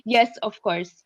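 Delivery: usual speaking voice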